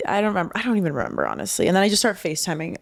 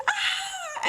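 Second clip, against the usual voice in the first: soft, high-pitched mock scream; imitated squeal of joy; high excited squeal; 7 dB quieter than usual. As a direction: squeal high without much volume. Acting out his proposal reaction.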